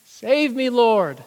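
high, loud plea for rescue; fearful cry. Acted. Sinking Peter begs Jesus to save him, crying out. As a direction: cry out for help in sudden panic. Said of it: "cried it out intuitively".

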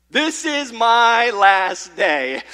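loud, drawn-out mock despair; hammed-up dread, slow and loud; dramatized doom. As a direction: stretch each word out slowly and loudly, like a last lament. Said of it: "I was thinking to myself".

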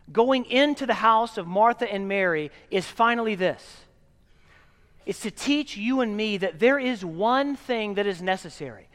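ordinary talk, nothing marked

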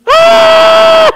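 put-on very high, loud child's horn shout; kid's shofar blast. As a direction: blare it like a toddler blowing a horn. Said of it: "He was like".

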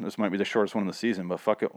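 ordinary delivery

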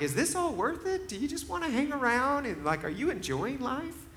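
high, tender questions to the dog; a soft, high coaxing voice; gentle asking. Retold or imitated. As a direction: ask the old dog softly, kindly. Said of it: "I'm like".

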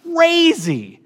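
high, drawn-out exclamation; wild excitement. Retold or imitated. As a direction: voice leaping high on the word. Mimics the crowd's wild excitement.

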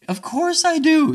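high, loud, drawn-out admission; emphatic admission. Acted. Speaker readily admits to being selfish, emphatically. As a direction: own up with comic emphasis.

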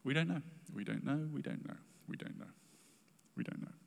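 a refrain that fades from full voice to breath; fading repetition. Real, not acted. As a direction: let the words die away. Repeated refrain fading away.

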